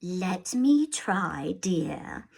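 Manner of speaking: slow soft whisper; calm whisper. Acted. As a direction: soothe him gently. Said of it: "placid and calm".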